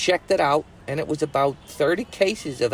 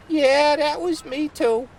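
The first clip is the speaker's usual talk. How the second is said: put-on much higher, slow girlish voice; timid Betsy voice; 12 semitones higher, slower than usual. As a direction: admit it sheepishly, high and slow. Betsy admits again.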